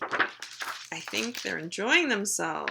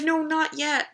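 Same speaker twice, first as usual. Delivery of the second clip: higher-pitched little protest; reluctant puppy; 7 semitones higher than usual. Acted. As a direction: plead lightly as the puppy not ready for bed. Puppy refuses bedtime.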